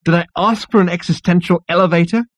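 high-pitched irritated outburst; exasperated. A narrator's reading. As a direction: ask in rising frustration. Zaphod exasperated, beats the wall.

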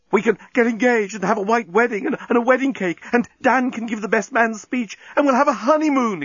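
high, gushing excitement; exclaiming. A narrator's reading. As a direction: gush the plans at high pitch. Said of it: "exclaimed the journalist".

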